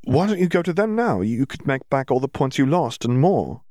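high-pitched, urging character voice; eager Hermione. Acted. Hermione urges winning back the points, eager. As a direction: urge them on, starting high.

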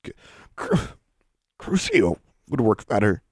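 stammered out between painful breaths; pained, halting. A narrator's reading. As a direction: struggle to get the words out. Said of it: "between painful breaths".

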